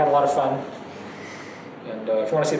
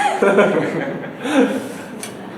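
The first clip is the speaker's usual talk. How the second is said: laughing; 4 semitones higher than usual. Real, not acted. Laughing at his own impression.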